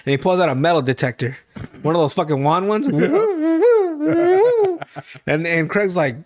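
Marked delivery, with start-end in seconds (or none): 2.9-5.0 a sudden high squealing laugh. Real, not acted. Cracks up at own story.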